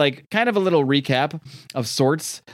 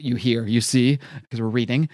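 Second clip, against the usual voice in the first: ordinary talk, nothing marked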